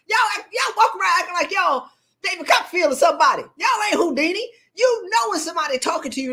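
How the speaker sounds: high-pitched exasperated rant; worked-up yelling, pitched high. Real, not acted. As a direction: scold the crowd in a high, worked-up voice. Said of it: "I wasn't supposed to be yelling".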